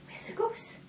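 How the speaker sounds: high breathy whisper. Acted.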